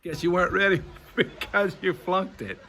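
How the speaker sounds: laughing. Real, not acted. Laughs at flunking students.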